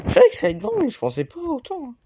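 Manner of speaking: high amazed exclaim. Real, not acted. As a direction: gasp at how big it is. Étonnement devant le lieu. (stupéfait)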